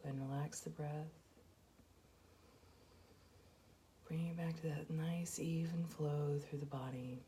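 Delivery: soft, even meditation voice with long pauses; guided meditation. Acted. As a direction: guide them gently through each breath, hushed and unhurried. Leads a guided breathing meditation.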